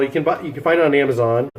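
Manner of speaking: ordinary talk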